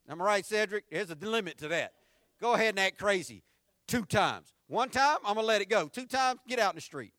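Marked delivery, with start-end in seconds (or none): 2.4-7.1 fed-up mother. Acted. Preacher voicing fed-up mother.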